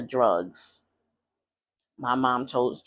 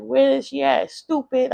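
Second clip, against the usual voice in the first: high, louder, exasperated outburst; retold child's anger; 11 semitones higher, 4 dB louder than usual. Child left alone, waiting for mother, mad. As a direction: act out an indignant child, pitched well up. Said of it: "I'm mad 'cause I'm like".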